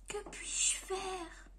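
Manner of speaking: hushed inner voice; whispered thought. Acted. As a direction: wonder aloud in a discouraged whisper. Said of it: "pensa-t-il".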